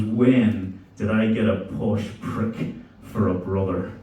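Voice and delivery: slow Belfast voice; drawn-out, disgusted Belfast brother; Belfast accent. Retold or imitated. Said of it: "very Belfast brother".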